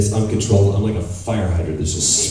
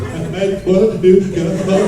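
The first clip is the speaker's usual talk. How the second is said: put-on small child; 11 semitones higher than usual. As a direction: plead like a lost toddler at daycare. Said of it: "like a three-year-old in a daycare".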